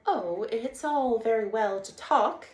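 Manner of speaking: slow, loud, sulky complaint; Mole's pettish grumble to Rat. Acted. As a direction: whine it like a put-out mole. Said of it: "said the mole rather pettishly".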